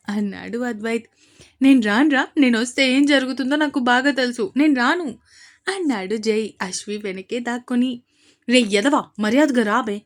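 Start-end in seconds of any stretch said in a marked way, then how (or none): 1.6-5.1 stubborn, shouted no; loud refusal. Acted. Jai shouting refusal at Advaith from behind his sister, loud. 8.5-10.0 loud scolding shout; angry yell. Acted. Advaith yelling at Jai to come out, angry.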